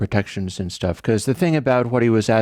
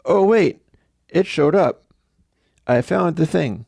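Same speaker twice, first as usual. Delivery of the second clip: higher, faux-surprised discovery; mock surprise; 6 semitones higher than usual. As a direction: act like you just found it. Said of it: "he turns around and goes".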